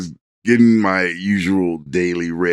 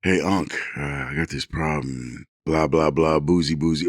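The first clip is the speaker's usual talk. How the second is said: lightly voiced godson asking for help; hint of a kid confessing a problem; mumbling kid; 7 semitones lower, 7 dB quieter than usual. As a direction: lower and softer, a sheepish nephew. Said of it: "he's like".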